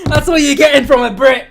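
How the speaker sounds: loud laughing punchline; laughing as he lands the joke. Real, not acted. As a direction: laugh through the line. Laughing at his own joke.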